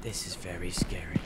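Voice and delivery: hushed, voiceless whisper. Real, not acted. Player creeping through a scary place with a friend, whisper. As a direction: whisper it under your breath.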